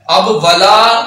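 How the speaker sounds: usual speaking voice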